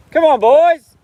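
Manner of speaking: loud high call across the paddock; hollering to the horses. Real, not acted. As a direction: shout up to the horses to come down. Calling horses down the hill.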